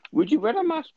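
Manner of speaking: sudden high squeak of disbelief; incredulous squeak. Real, not acted. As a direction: ask it in a high, mock-shocked yelp. Incredulous joke about beach mask.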